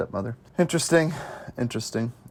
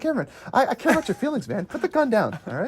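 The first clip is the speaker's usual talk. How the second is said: high, pleading hostage negotiator voice; mock negotiator; 7 semitones higher, faster than usual. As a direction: talk him down in a high, soothing plea.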